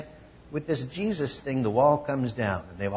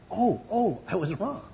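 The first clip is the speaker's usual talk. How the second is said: mock admission; 6 semitones higher than usual. Imagined admitting you're wrong.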